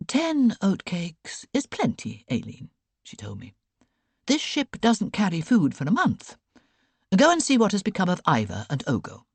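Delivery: crisp, knowing aunt passing on sailors' news; Aunt Beck's voice. A narrator's reading.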